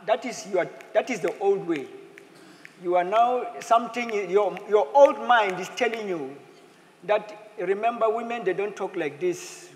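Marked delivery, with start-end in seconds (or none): none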